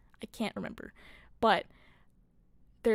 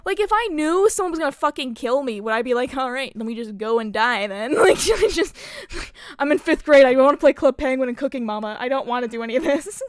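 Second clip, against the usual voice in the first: louder, pitched-up comic outrage; indignant protest; 3 semitones higher, 8 dB louder than usual. Retold or imitated. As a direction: play an exasperated kid protesting, laughing partway through. Acts indignant fifth-grader protesting.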